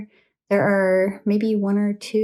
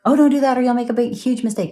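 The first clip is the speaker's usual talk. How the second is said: higher, louder, fast hammed-up warning; mock alarmed warning; 5 semitones higher, 5 dB louder, faster than usual. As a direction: rattle it off as an exaggerated scolding, pitched up. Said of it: "it's not even like a".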